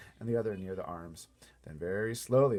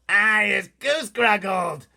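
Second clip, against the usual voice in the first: much higher, much louder giant howl; giant's howl; 12 semitones higher, 15 dB louder, slower than usual. Acted. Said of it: "howled the meat hugger".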